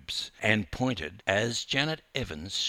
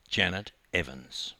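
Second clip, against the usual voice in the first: usual speaking voice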